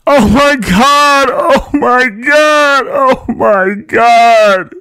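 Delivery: very high, loud, slow drawn-out screams; shouting in terror. A narrator's reading. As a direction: scream it from the top of the stairs. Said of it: "shouting at the top of her lungs".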